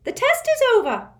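high, loud prim official voice; a strict, shrill-free schoolmistress; stern test administrator. A narrator's reading.

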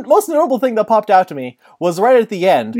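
ordinary delivery

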